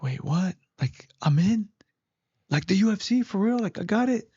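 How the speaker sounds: high, questioning re-enacted surprise; incredulous questions rising in pitch; stunned disbelief. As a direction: act out hearing unbelievable news. Acts out his stunned reaction.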